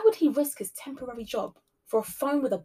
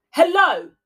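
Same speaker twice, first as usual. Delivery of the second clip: loud high shout; frantic shout; 8 semitones higher, 11 dB louder than usual. A narrator's reading. Cleaner chasing laughter down the hall, shouting frantically. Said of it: "she shouted frantically".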